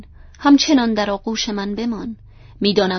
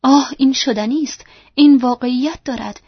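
a louder, excited cry of disbelief turning to joy; joyful cry; 4 semitones higher, 6 dB louder than usual. A narrator's reading. Rochester to Jane, realising she is real: excited cry. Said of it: "او فریاد زد".

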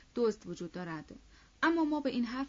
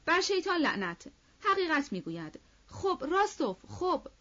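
high, loud outburst; a sudden high, loud cry of support; shouting; 8 semitones higher, 5 dB louder than usual. Acted. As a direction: Denisov bursts out shouting. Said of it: "فریاد کشید".

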